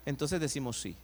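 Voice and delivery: ordinary delivery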